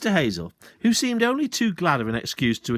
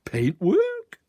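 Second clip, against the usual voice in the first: much higher feigned puzzlement; mock surprise; 4 dB louder than usual. Sergeant feigning surprise at the landowner. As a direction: pretend not to understand.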